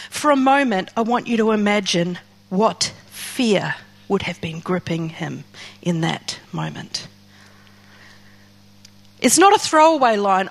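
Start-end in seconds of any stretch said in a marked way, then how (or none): 1.5-7.1 hushed. Real, not acted. Preacher hushes to imagine fear.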